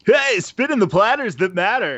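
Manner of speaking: mock a phony radio jock; super fake voice. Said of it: "the super fake voice".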